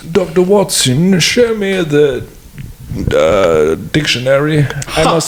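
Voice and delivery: a hammed-up British accent, way up high; mock Sherlock Holmes. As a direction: play Sherlock as a pompous fool, drawn out. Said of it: "British accent".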